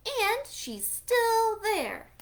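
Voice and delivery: put-on baby bear. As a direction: drag it out like a startled little cub. Baby Bear finds Goldilocks.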